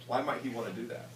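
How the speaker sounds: usual speaking voice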